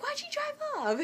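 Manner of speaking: very high-pitched exasperated outcry; retold frustrated yell. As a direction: re-enact a thwarted, protesting yell. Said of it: "I just wanted to yell at her".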